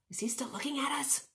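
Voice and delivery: soft, quick whispered question; nervous hushed storybook aside; furtive whisper. A narrator's reading. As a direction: whisper it while hiding. Hiding character asks nervously.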